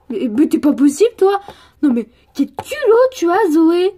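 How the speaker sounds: loud, outraged telling-off; acted angry outburst; indignant scolding. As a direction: scold a friend in open outrage. Friend scolds Zoé in acted quarrel.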